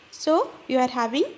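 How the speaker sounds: ordinary talk, nothing marked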